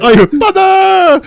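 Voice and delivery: very high, drawn-out wail; mock scream. Mimicking the daughter's cry for Father, high mock scream. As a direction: imitate a melodramatic shriek.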